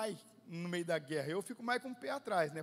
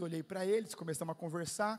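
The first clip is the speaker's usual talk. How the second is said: usual speaking voice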